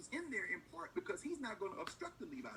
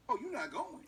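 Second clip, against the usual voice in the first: high-pitched, louder mock reaction; mock surprise; 7 semitones higher, 6 dB louder than usual. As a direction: play an official taken aback by a refusal. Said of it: "He's speaking to the president of Haiti".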